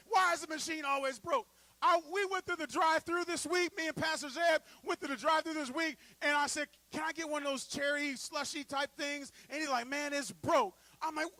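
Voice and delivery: loud, high, animated telling of the drive-thru story; fired-up preaching. Acted. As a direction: preach it with rising fire. Loud excited preaching of anecdote.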